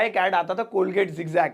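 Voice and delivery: ordinary delivery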